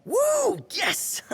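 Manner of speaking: high, delighted whoop; excited cheer. Acted. Prompto cheering after his friend agrees to come, a high delighted whoop. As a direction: cheer like you just won.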